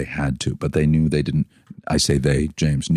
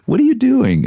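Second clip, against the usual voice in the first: much higher, louder startled question; surprised; 5 dB louder than usual. Retold or imitated. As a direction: jump up in pitch, caught off guard. Startled at a champagne ambush.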